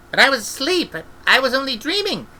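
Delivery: put-on high pleading child's voice; protesting girl. Esk protesting to Granny in a high child's voice. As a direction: insist like a frightened child.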